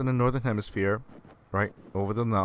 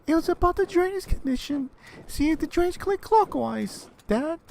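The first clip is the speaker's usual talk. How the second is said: put-on squeaky kid. Kid explaining the drainage call to his dad, squeaky high voice. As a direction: pipe up innocently high to dad.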